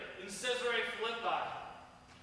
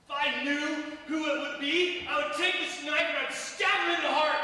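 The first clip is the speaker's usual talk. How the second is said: loud, rising threat of revenge; angry vow; 5 semitones higher, 6 dB louder than usual. Acted. Peter swearing vengeance on the traitor, loud and angry. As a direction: spit out the vow to kill.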